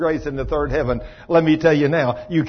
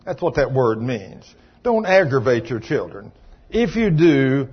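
ordinary delivery

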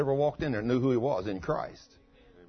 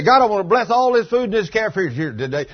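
bold proclaiming prayer, louder; a preacher calling out over lunch; loud blessing; 8 semitones higher, 10 dB louder than usual. Retold or imitated. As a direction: declare the blessing to the whole room. Standing to bless the food.

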